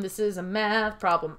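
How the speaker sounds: ordinary talk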